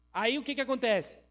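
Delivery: usual speaking voice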